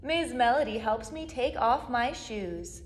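ordinary delivery, nothing marked

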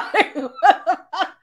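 loud high laughter. Real, not acted. Still laughing.

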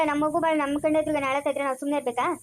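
usual speaking voice

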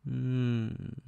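ordinary delivery

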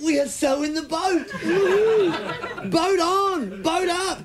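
high, loud, whooping with laughter; wild whooping. Retold or imitated. Tripping mates on the pier, whooping high and loud. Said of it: "We're just in the boat going".

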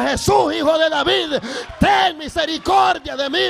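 high, loud imploring shout; shouting harder. Retold or imitated. Blind man calls to Jesus despite the crowd, shouting harder. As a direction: shout the plea over the crowd. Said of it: "aquel hombre más gritaba".

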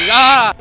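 sudden high scream at a jump scare; startled scream. Real, not acted. Gamer jolted by jump scare, screaming. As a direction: shriek as the animatronic lunges.

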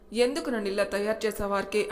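plain reading voice

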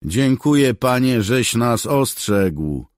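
loud, high, slowed thanks; calling out. Acted. Soldier calls thanks after the leaving cat, loud. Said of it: "zawołał Bark".

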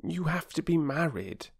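put-on high girlish caution; warning. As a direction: point out the catch as a little girl. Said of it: "warned Phyllis".

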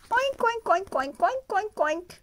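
high, loud quick oinks; excited piglet chatter; piglets oinking. Acted. Piglets on the haystack, loud high oinks. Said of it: "say the pigs".

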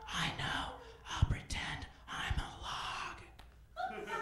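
hushed, whispered stalking; sneaky alligator whisper. Pretend. Comic's alligator impression, creeping up in a whisper. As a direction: whisper like a lurking gator. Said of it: "my impression of an alligator eating pussy".

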